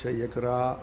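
a low, gentle don't; gently. Shown as a demonstration. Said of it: "మెల్లగా మాట్లాడతారా".

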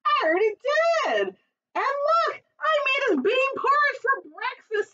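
a bubbly mom proud of her porridge; cheerful mother. Acted.

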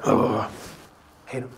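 hushed resentful grumbling of a churchgoer; inner grumbling. Retold or imitated. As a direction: grumble it like resentful private thoughts. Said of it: "But inside they're grumbling".

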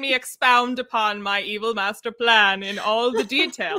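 hammed-up, loud, slow groaning villain; mock dying villain. Mocking the stabbed villain's long speech, hammed-up and groaning. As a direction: ham up a stabbed villain monologuing. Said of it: "while I'm like".

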